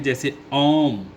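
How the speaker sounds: ओम drawn out slowly, vowel held long; prolonged om. Shown as a demonstration. Teacher to pupils, holding the ओ in ओम long. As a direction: stretch the ओ in ओम. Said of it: "तो ओम में क्या करते हैं".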